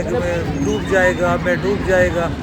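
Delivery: ordinary talk, nothing marked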